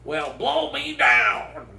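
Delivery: high, slow cartoon sailor voice; Popeye impression. Comic doing a Dave Coulier Popeye bit, high and slow. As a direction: squint and growl it like Popeye. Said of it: "Dave Coulier".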